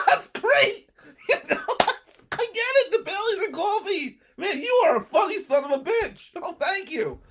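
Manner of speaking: put-on high, loud, cackling drunk guy, laughing between words; loud, high laughing praise from a tipsy buddy; laughing drunk friend. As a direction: boom it out like a delighted drunk who finds you hilarious. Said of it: "And he laughs like".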